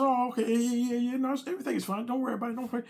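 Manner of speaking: high, hurried reassuring; nervous reassurance. Retold or imitated. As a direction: brush it off too fast. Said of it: "So I said".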